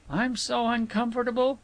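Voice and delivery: high-pitched fretting complaint; fretful. A narrator's reading. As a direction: fret in a high voice. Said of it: "fretted Istra".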